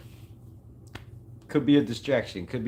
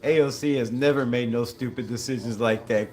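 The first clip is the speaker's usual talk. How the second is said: usual speaking voice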